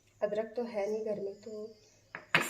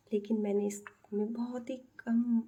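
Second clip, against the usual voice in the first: hushed voice. Real, not acted. Cook whispering to viewers so the family isn't disturbed. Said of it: "अपनी आवाज़ को दबा के ही बोलना पड़ेगा".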